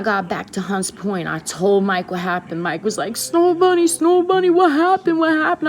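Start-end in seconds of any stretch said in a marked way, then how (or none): 3.2-5.7 high, loud, alarmed cries; alarmed calling out. Retold or imitated. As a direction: call out to her in alarm. Mike alarmed at her state.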